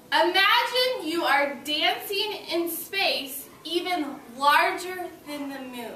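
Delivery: slow, expressive storybook reading; teacher reading the earth book to class; reading aloud to children. Acted. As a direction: read it slowly to wide-eyed kids.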